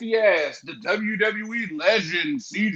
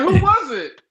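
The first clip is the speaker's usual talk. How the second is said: mom's voice; 11 semitones higher, 6 dB louder than usual. Retold or imitated. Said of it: "she's like".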